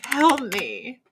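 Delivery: a hint of witchy croak; witch voice. Streamer playfully begging for help, witch voice. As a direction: plead in a put-on witch voice. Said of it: "Here she goes with the witch voice".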